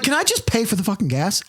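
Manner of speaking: high exasperated outburst. Retold or imitated. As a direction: snap at the pump. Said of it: "I'm like".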